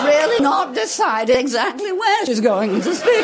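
high, slow voice slipping between registers; exaggerated, wavering high politician impression; Anne Widdecombe. Mocking a politician's undecided voice, high and slow. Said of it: "is Anne Widdecombe".

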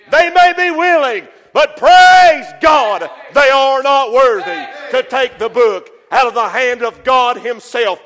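sermon climax shout; preaching shout. Acted. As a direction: lift it up and shout the praise. Preacher climax, praise God.

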